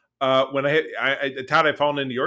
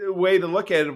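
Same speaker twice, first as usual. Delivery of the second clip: normal talking voice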